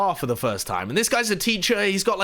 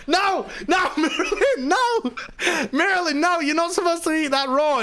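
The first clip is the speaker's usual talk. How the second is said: high, laughing urgent protest; mock alarm; 11 semitones higher than usual. Friends stopping their guest eating raw bacon, high alarmed protest. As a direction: plead frantically, high-pitched.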